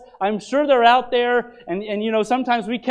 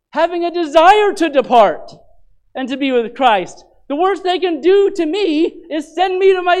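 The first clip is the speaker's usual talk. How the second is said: higher, louder preaching that climbs as it goes; full-force preaching; 5 semitones higher, 5 dB louder than usual. Acted. Pastor to the church in Philippi, preaching at full force. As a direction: preach it out, rising to the peak.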